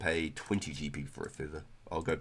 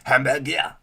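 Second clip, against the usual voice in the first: high, loud "Hamburger" with a twang; twangy hamburger; 7 semitones higher, 9 dB louder, slower than usual. Shown as a demonstration. Mimicking the French word for friends, with twang. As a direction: give "Hamburger" a foreign twang. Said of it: "got a bit of a twang to it".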